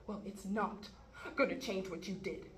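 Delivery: normal talking voice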